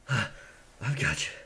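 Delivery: soft reassurance. Acted. Pirate comforting his wounded friend, soft and tender. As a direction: hold him gently and calm him.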